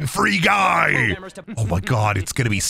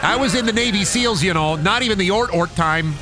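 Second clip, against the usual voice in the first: put-on Minnesotan accent. Streamer voices a Minnesotan dock worker enemy, Minnesotan accent. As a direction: lean into the Minnesota "oh, dude" lilt. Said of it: "Minnesotan dock workers".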